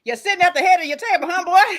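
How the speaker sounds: high, loud, quick taunt through laughter; mock scolding. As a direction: lightly play a scolding Seiko. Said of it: "Seiko was like".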